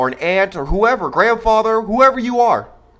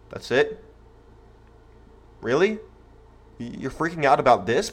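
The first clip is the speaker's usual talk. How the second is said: dismissive disbelief; 4 semitones lower, 6 dB quieter than usual. Retold or imitated. Parent's unspoken dismissive reaction.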